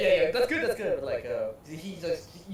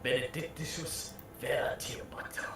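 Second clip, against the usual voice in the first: slow unvoiced Latin chant; whispered battle cry; 3 semitones higher, slower than usual. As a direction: breathe the battle cry slowly. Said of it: "their own little cool battle cry".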